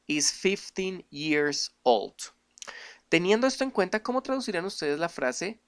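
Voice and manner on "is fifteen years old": very slow, careful English sentence; slow English demo. Shown as a demonstration. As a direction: model the possessive slowly. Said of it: "esto se pronuncia".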